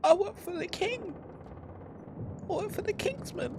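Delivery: very high, thin, soft captive voice; timid captive. Acted. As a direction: plead in a small high-pitched voice. Game master plays frightened captive.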